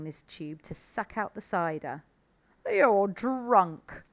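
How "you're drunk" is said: lightly voiced calm fox father; steady, knowing father fox; Mister Fox voice. A narrator's reading. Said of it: "said Mister Fox".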